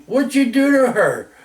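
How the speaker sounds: high-pitched retold demand; imitated fatherly accusation; accusing dad. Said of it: "my dad looks at me".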